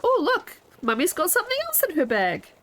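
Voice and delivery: high bright mock excitement for kids; excited mummy voice. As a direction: sell the surprise to bored kids. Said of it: "I can, uh, go".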